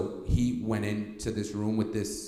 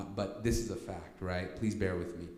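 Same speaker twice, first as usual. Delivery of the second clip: usual speaking voice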